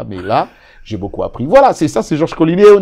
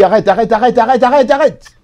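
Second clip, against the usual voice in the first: higher and louder repeated stop; insistent stop; 14 dB louder than usual. Retold or imitated. Veteran mentor cutting off the shouting rookie, loud. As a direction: cut him off again and again.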